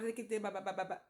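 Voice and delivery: rhythmic babbled syllables; imitating speaking in tongues. Testifying to the church, imitated tongues prayer. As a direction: let the tongues syllables roll out. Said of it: "empecé a orar".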